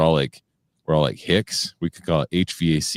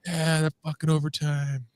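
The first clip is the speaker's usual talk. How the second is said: hyped fan cheer; 13 semitones higher, 3 dB quieter than usual. Retold or imitated. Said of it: "just like".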